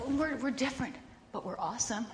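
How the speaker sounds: ordinary talk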